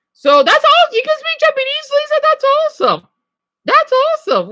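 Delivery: high, loud, squealing delight; excited squeal. Real, not acted. To viewer Lisa, a high excited squeal. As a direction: gush at the good news.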